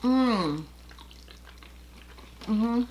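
chewing while humming; talking between bites; mouth full of chicken. Real, not acted. Seen 0: Right woman chews chicken, lips closed and moving, throughout the words.

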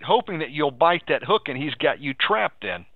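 ordinary delivery

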